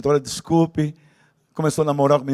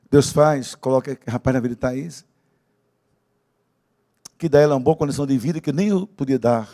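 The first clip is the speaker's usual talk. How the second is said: ordinary delivery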